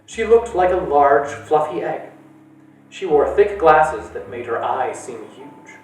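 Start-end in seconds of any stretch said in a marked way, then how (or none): none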